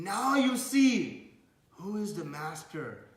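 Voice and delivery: slow, loud, high "now you see"; triumphant declaration. Retold or imitated. Advaita declares who is master while beaten, triumphant. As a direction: proclaim it with acted triumph.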